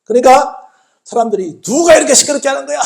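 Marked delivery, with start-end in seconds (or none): 1.6-2.8 loud, high-pitched telling-off; imitating an irritated crowd's rebuke; scolding. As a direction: snap at a noisy nuisance. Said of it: "혼을 냅니다".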